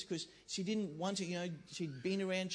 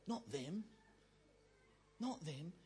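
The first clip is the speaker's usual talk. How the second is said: disapproving aside; 8 dB quieter, slower than usual. Pretend. Preacher voicing someone who prefers the poor, quiet disapproving aside. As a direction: drop it to a pointed undertone. Voices objection to giving leaders money.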